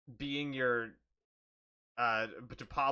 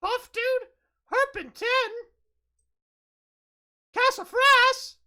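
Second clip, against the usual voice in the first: put-on high confused little kid; small child voice; 6 dB louder than usual. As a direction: ask each name like a lost child. Child Pablo guessing parents.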